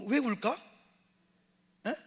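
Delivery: ordinary talk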